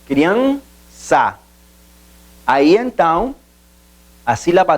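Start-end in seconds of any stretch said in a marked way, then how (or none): 0.0-1.4 "criança" split as crian, ça; "criança" in two detached syllables. Shown as a demonstration. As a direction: hold "crian", pause, then "ça" in "criança". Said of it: "separação silábica".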